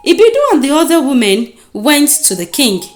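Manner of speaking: plain reading voice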